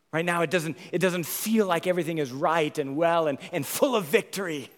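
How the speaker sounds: preacher admitting hard times with fire; fervent sermon. Acted. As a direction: press the point earnestly.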